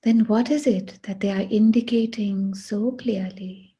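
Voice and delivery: ordinary talk, nothing marked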